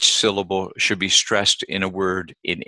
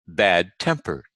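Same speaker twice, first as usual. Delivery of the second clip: bad-tempered with TEMP stressed; bad-tempered, weight on the second part. Shown as a demonstration. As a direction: punch TEMP in bad-tempered, then fall. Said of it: "stress the second part".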